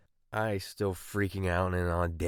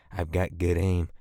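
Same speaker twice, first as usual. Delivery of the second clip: usual speaking voice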